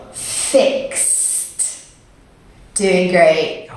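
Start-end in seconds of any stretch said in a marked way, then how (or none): 0.1-1.9 "Fixed" with the x held long, then a separate t; drawn-out model word. Shown as a demonstration. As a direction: stretch the ks in "Fixed" before releasing t. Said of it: "Can you say that with me?".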